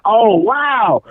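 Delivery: high, called-out mock wow; retold amazement. Cousins and uncle seeing the drum set, imitated amazed shout. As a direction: exclaim it like a stunned onlooker. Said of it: "they're like".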